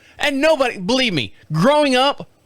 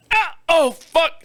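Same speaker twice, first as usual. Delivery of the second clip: high, sudden yelp and curse; retold pain outburst; 10 semitones higher, slower than usual. Lifting a door with sore ribs, mock pained yelp. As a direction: cry out as if caught by pain.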